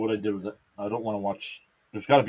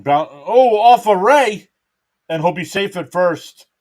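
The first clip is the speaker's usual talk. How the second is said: excited; 6 semitones higher, 8 dB louder, slower than usual. Real, not acted. Reacting to hit off pitcher.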